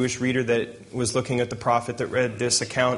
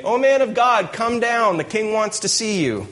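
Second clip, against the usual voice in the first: much higher, louder call that fades toward the end; calling up the hill; 9 semitones higher, 3 dB louder than usual. Acted. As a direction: shout the summons up to a distant hilltop. Captain calls up to hilltop prophet.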